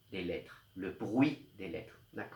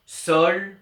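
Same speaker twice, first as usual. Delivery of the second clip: sol said louder and higher; stressed sol; 7 semitones higher, 9 dB louder than usual. Shown as a demonstration. Teacher showing pupils the rhyme, sol said loud and high. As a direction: pop out the syllable sol. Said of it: "on entend".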